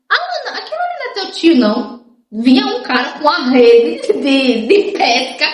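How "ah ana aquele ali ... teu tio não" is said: high quick retold question; pitched-up curious friend asking quickly. Friend on the beach asks Ana, high and quick. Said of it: "do nada uma pessoa diz assim".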